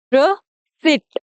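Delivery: रिसिप्ट drawn out as "ruh... seet"; slow teaching model of रिसिप्ट, "ruh-SEET"; slow silent-p "receipt". Shown as a demonstration. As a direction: break रिसिप्ट slowly in two, no p. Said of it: "इसको बोलते हैं".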